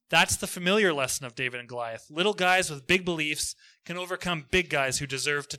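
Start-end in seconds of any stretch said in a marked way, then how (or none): none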